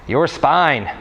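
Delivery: ordinary talk, nothing marked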